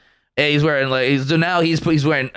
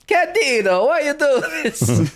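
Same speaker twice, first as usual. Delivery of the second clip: a much higher, slow mock-exasperated parent voice; a fed-up mother scolding her son, drawn out; 11 semitones higher, slower than usual. Joking about the Jacuzzi baby, high scolding parent voice.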